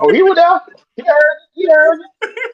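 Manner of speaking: laughing high. Real, not acted. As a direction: laugh till you almost cry. Laughing at friend's story.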